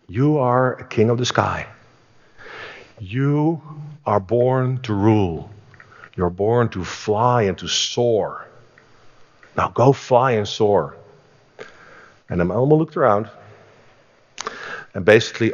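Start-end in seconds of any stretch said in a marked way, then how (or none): 0.1-11.0 slow, grand call to soar; solemn exhortation. Retold or imitated. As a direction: rouse the bird like a king. Friend urges eagle solemnly.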